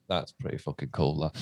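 low, soft murmur to oneself; understated awe. Retold or imitated. Driving past own album poster, quietly impressed. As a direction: re-enact quiet private approval, dropped right down. Retold private reaction passing poster.

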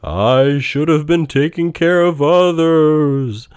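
loud, high, slow spectral voice; booming ghost. A narrator's reading. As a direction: boom it out like a phantom. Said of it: "said the ghost".

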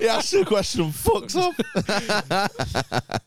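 high, helpless real laughter through the words; laughing. Real, not acted. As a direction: crack up while teasing. Cracking up at the failed question.

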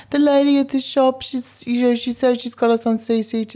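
high panicky girl voice, mimicked; squeaky distressed teenager, imitated; imitating a frantic teenage girl; mock upset teen. Teen girls calling, crying, retold.

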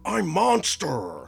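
Keanu Reeves's monster shtick. Retold or imitated. Mocking a movie dad playing with his kids, goofy monster voice. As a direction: play a silly monster for the kids. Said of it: "His shtick at the beginning with the kids".

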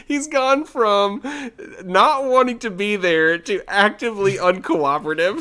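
high, loud, laughing through the words; cracking up. Real, not acted. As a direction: struggle to talk through helpless laughter. Speaker cracks up while talking.

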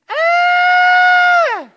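very high, sustained mock scream; long piercing shriek at full volume; imitated loud scream. Woman seeing her leg grow out, imitated loud scream. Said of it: "She screamed real loud".

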